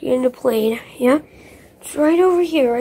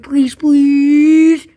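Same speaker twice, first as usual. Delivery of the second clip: louder begging please; loud pleading; 8 dB louder than usual. Real, not acted. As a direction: plead loudly to the viewers. Begging viewers to subscribe.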